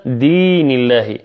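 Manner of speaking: دين held long on the ya, slow; stretched madd. Shown as a demonstration. Teacher repeating the example with clear lengthening. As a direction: draw out دين for two beats. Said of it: "فلا بد من المد والتمطيط هنا".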